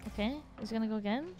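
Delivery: normal talking voice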